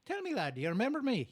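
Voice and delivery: high-pitched Irish lilt; Irish leprechaun. Acted. Leprechaun greeting the golfer, high Irish voice. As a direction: pop up as an eager Irish leprechaun. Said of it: "the leprechaun pops out".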